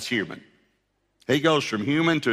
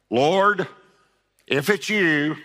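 Peter's doubt; 5 semitones higher, 3 dB louder, slower than usual. Acted. Peter voiced again.

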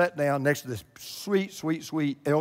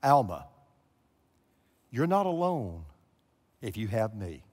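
the voice of God; 6 semitones lower than usual. Retold or imitated. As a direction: speak it gently as the voice she heard. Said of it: "these words".

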